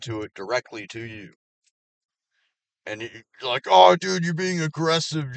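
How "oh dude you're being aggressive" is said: higher, louder whiny imitation of the caller; mocking quote. Mimicking the prank caller, whiny mock voice. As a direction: parrot him like a whiner.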